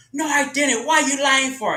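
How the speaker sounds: high, loud indignant denial; angry denial. Retold or imitated. Older sister denying the accusation to her brother, indignant and loud. Sister denies in retold quarrel.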